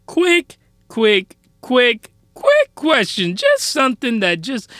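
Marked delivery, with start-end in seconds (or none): 0.1-3.4 drawn-out stammer. Acted. Stretched, stuttering buildup to rant.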